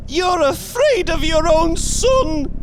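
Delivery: high, loud accusing cry; struggling cry. A narrator's reading. Wife struggling with her frightened husband, crying out. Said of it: "she cried, struggling".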